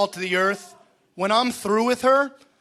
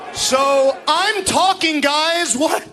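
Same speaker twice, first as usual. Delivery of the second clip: raised, high-pitched exasperation; irritated scolding; 5 semitones higher than usual. Acted. Wrestler tells noisy crowd to shut up, exasperated scolding. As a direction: demand silence, ending on a sharp shout.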